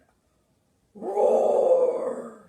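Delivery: slow, very loud roar. A narrator's reading. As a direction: roar it out slowly like a lion. Lion roars.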